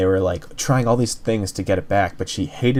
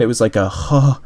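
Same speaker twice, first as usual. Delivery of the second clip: ordinary talk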